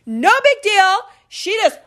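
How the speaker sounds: high, loud, slow tearful wail; sobbing boyfriend. Retold or imitated. Boyfriend walking in on his family's party, high loud tearful wail. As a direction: wail it out like a heartbroken man. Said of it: "And he goes".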